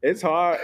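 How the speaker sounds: half-laughing emphasis on hard; emphatic. Real, not acted. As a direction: lean hard on the word hard.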